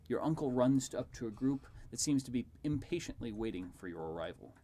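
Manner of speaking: plain reading voice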